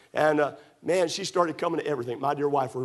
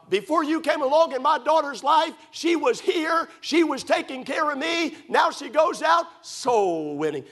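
high, loud mock drunken rant; imitating a furious drunk father; drunk father yelling; 11 semitones higher, 3 dB louder than usual. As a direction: shout it like an angry drunk dad. Drunk father cursing at preacher.

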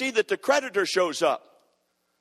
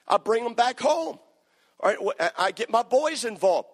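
ordinary talk, nothing marked

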